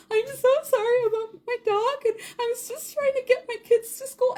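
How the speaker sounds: high, tearful apology; a light crying apology; sorry about the dog, lightly tearful and high; mock sobbing apology. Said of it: "sobbing uncontrolled".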